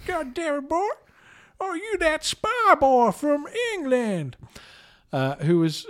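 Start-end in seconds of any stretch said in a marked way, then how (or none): 0.1-4.4 hammed-up American Southern accent, slow and blustering; J W Pepper. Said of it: "was J W Pepper".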